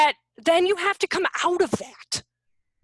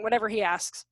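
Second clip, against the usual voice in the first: ordinary delivery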